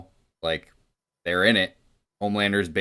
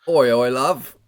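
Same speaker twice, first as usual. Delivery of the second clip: louder, higher Kiwi-style "Oi, oi, love"; Antipodean accent; 5 semitones higher, 6 dB louder, slower than usual. Acted. Friend shows the Butcher's accent, in a loud, broad Kiwi voice. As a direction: call it out like a rough New Zealander. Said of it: "It's Australian".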